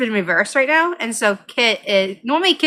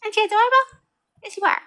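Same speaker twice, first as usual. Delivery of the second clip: very high, doting pet talk; cute cat tone; 12 semitones higher, much faster than usual. Real, not acted. Owner adoring her cat mid-chat, cutesy. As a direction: coo high at an adorable pet. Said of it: "the tone I use for my cat".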